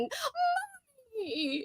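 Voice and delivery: mock horrified wail; appalled cry. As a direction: wail it like a horrified child calling for its mother.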